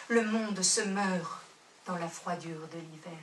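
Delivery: solemn reading fading to a hush; fading poem recital. Acted. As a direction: let the last refrain die away. Poem closes, refrain fading.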